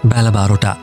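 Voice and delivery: ordinary delivery, nothing marked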